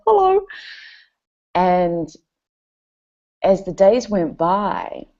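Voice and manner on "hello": timid greeting to dog. Retold or imitated.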